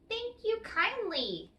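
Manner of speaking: high, little leprechaun thanks; small leprechaun voice. Acted. The leprechaun answers Tom while busy tapping, high little voice. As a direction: chirp it like a tiny cobbler.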